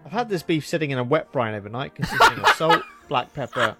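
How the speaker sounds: upbeat food video narration; cooking show voiceover. Acted. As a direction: sell the recipe like a cooking show host.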